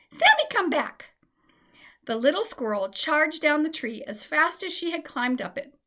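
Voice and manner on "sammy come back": high, loud call. A narrator's reading. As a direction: call her back, worried. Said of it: "she cried".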